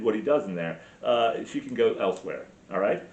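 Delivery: ordinary talk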